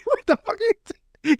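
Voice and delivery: very high imitated plea; panicked mock squeal. Voicing Timmy backed in the corner, panicked mock squeal. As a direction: squeal it like cornered prey, playing it up.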